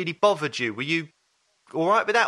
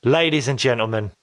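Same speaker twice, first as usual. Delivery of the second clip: announcer voice; 4 dB louder than usual. Acted. Announcer introduces the guest.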